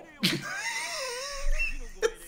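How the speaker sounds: high shrieking laughter, out of control; helpless laughing fit. Real, not acted. Giggling fit while streaming a strategy game, shrieking laughter. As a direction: lose it completely, cackling. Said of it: "Sorry, I know it's wrong".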